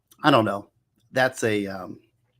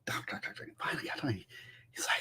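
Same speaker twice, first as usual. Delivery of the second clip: whispered mimicry; 16 dB quieter, faster than usual. Whispered mimic of endless talk.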